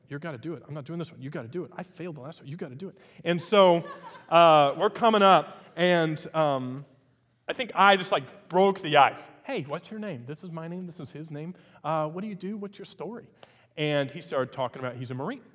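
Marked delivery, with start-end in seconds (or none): 0.1-3.0 soft, quiet insistence; softly retold coaxing; hushed urging. Urging nervous partner quietly. 9.4-13.3 quietly retold, gentle introductions; hushed friendly chat; soft friendly greeting. Retold gentle approach to stranger.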